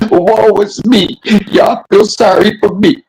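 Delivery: mock her self-pity slowly; sarcastic pity-me whine; mocking woe. Mocking her as playing victim.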